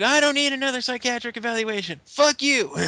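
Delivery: high, mock outraged protest; indignant refusal. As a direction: snap back like an offended wrestling heel. Acts Daniel Bryan angrily refusing evaluation.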